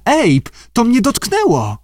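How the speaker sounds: very high panicky cry; frightened squeal. A narrator's reading. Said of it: "piszcząc odsuwała coś". (przestraszony, wysoki pisk)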